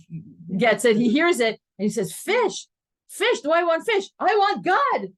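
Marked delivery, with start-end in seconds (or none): 2.1-5.1 ecstatic outburst. Retold or imitated. Rebbe waking from ecstasy.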